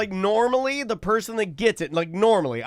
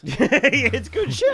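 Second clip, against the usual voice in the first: laughing; 5 semitones higher, 4 dB louder, much slower than usual. Real, not acted. Laughing while speaking.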